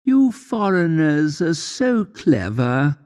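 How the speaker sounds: a touch of a sweetly snide woman; condescending woman. Retold or imitated. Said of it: "a woman said to me".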